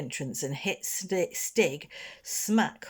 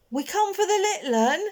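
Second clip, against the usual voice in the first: put-on timid boy; 5 dB louder than usual. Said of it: "said the other".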